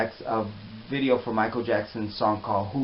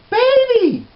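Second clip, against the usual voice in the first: high-pitched squealed call, drawn out; imitated delighted shriek; excited greeting; 11 dB louder than usual. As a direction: cry it out loud and high on spotting a dear friend. Friend spots him, runs over.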